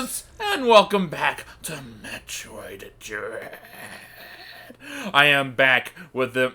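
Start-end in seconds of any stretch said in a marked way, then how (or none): 0.0-3.6 slow, gravelly deranged gargoyle. Acted. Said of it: "my deranged gargoyle voice".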